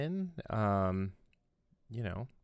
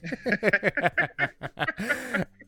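laughing; 9 semitones higher, 4 dB louder than usual. Real, not acted. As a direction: burst of real laughter. Laughs at own horn sound.

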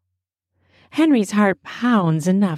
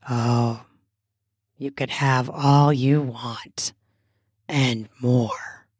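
lower, slow lustful man; low seductive voice; 7 semitones lower, slower than usual. A narrator's reading.

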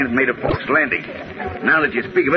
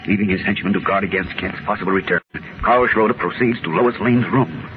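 dramatic radio narration; old radio announcer; 4 semitones lower than usual. A narrator's reading. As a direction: tell it like a serial narrator.

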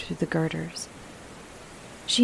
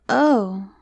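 louder dismayed little exclamation; dismayed child; 3 semitones higher, 6 dB louder than usual. A narrator's reading. Miranda hears of the girl eater, dismayed. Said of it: "said Miranda in dismay".

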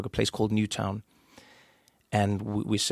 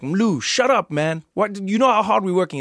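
annoyed comedian; 8 semitones higher, 5 dB louder than usual. Retold or imitated. Comedian snaps back at heckler.

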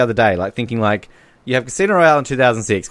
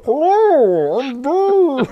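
put-on high falsetto old nanny; squeaky high Scottish nanny; Mrs Doubtfire voice; 3 dB louder, much slower than usual. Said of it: "sounded like, um, uh, Missus Doubtfire".

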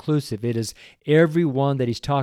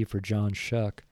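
ordinary delivery

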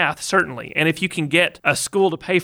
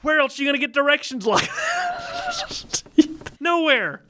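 much higher, near-shouted delight; excited exclamation; 11 semitones higher than usual. Real, not acted. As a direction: burst out in amazement at the directions. Marvelling at Welsh directions.

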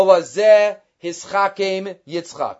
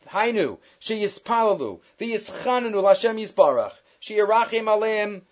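ordinary talk, nothing marked

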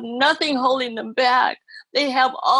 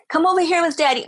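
mock coaxing dad; 7 semitones higher, 5 dB louder, much faster than usual. Parent calls child at pool.

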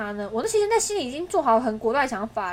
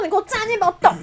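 raised, mock-angry command; retold angry order; 7 semitones higher, faster than usual. Girlfriend stops boyfriend leaving, retold angry order. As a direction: order him to stay, pitched up. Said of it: "我就很生气".

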